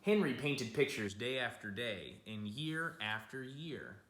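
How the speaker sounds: gentle picture-book storytelling; storybook voice for kids; reading to children. A narrator's reading. Reads picture book aloud.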